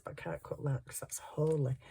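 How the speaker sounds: soft whispered aside, no voice. Real, not acted. Joking to viewers about holey lace, a whispered aside. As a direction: drop to a secret whisper.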